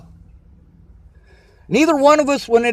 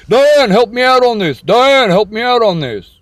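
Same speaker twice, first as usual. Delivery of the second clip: mocking dad; 3 semitones higher, 3 dB louder than usual. Mimicking dad appealing to Diane.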